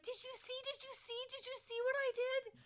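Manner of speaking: can't contain himself; thrilled goblin. Acted. Goblin demanding the party saw his spell, very high.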